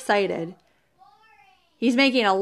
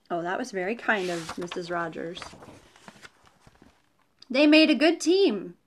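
usual speaking voice

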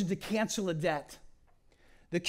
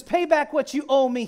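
louder, higher demand; harsh demand; 8 semitones higher, 11 dB louder than usual. Acted. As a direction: bark it at the debtor. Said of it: "he demanded".

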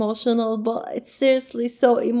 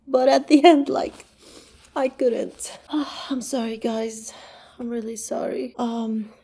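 real tearful sadness. Real, not acted. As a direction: struggle to hold it together, sighing between phrases. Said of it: "sensed that I was crying".

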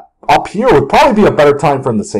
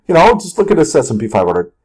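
ordinary delivery

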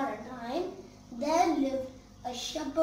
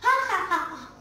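cheeky ha-ha, higher and louder; mocking laugh; 5 semitones higher, 7 dB louder, faster than usual. Boy mocking the villagers, cheeky laughing. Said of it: "The boy laughed".

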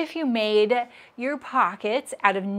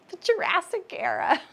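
higher, playful pun delivery; joking punchline; 9 semitones higher than usual. Retold or imitated. Cracking herself up telling mom a pun, higher playful. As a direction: toss off the joke, pleased with herself. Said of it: "I'm like".

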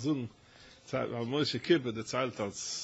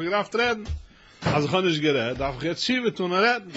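rising fervor; 6 semitones higher, 8 dB louder than usual. Acted.

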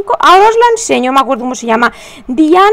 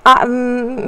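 normal talking voice

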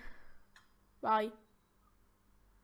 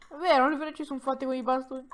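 usual speaking voice

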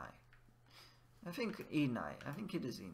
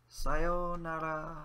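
Sayonara stretched very slowly, higher; slow sung-out farewell; slow, drawn out; 5 semitones higher, 6 dB louder, much slower than usual. Shown as a demonstration. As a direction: drag out each syllable of Sayonara. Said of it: "what do we say when we leave?".

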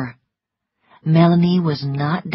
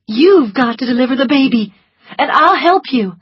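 higher, louder and quick; urgent; 7 semitones higher, 6 dB louder than usual. A narrator's reading. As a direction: press her to act fast. Scarlet urging Prissy.

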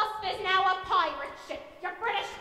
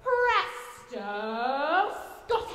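drawn out; 3 semitones lower, much slower than usual. Acted. Mock magic reveal.

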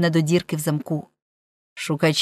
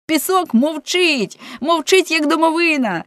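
excited cry; 9 semitones higher than usual. A narrator's reading. Peon to his father on finding the sand silent, crying out. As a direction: cry it out in a raised, high voice. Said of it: "Пеон закричав".

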